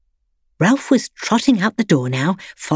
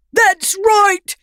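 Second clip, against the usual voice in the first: much higher, louder rallying shout; 4 dB louder than usual. A narrator's reading. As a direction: rouse the crowd of pets. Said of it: "shouted Freddy".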